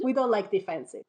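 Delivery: put-on villager in a comic Russian accent. Villagers explaining away the vanished Romanovs, Russian accent. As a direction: put on a Russian accent for simple-minded peasants. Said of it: "anybody listening with an actual Russian accent".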